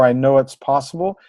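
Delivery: ordinary talk, nothing marked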